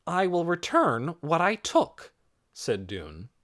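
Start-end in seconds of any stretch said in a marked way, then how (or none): 0.1-2.1 higher, deliberate promise; resolute vow. A narrator's reading. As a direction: declare it before everyone. Boy vows before the crowd.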